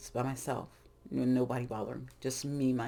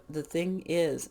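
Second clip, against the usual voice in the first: usual speaking voice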